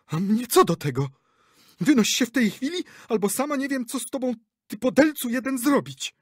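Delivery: very high, loud angry outburst; furious shout. A narrator's reading. Actress to director, furious shouting. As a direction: throw him out.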